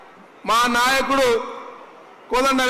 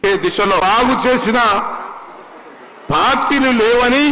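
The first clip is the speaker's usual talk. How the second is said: louder, declaiming to the crowd; rousing political speech; 4 dB louder than usual. Real, not acted. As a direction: proclaim promises to a big crowd. Rally speech at full force. (బిగ్గరగా ఉద్వేగభరిత ప్రసంగం)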